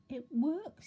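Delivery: high delighted surprise; amazed wonder. A narrator's reading. Mister Jelly after counting to ten, high amazed wonder. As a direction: say it in astonished wonder. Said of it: "he said out loud in wonderment".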